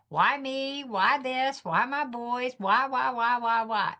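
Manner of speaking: high, pleading repeated whys; anguished why. Retold or imitated. Mother asking God why her boys, high and pleading. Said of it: "that night I'm like".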